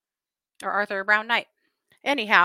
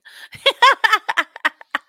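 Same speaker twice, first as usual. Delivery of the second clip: loud, high giggle; burst of laughter; 12 semitones higher, 11 dB louder than usual. Real, not acted. Vlogger laughing at her beads joke to chat, loud giggle. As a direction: laugh at your own joke.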